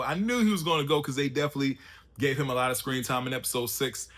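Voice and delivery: ordinary talk, nothing marked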